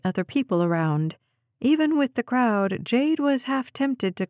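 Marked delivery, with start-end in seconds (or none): none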